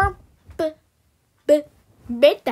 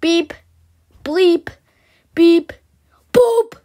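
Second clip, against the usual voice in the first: higher playful beeping, louder to a squeaky boop; mock beeps; 4 semitones higher, 5 dB louder than usual. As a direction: beep like a little robot, building up. Imitating machine beeps.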